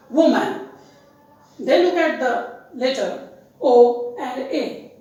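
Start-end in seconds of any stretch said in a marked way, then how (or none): 0.1-0.7 "woman" said WO-man, with a full A; common mispronunciation. Shown as a demonstration. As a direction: say "woman" as spelt, ending in MAN. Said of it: "Generally, people pronounce it as".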